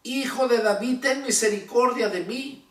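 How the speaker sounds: a restrained, insistent cry in scripture reading. A narrator's reading. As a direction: lift the pitch high and call for mercy. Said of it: "él clamaba mucho más".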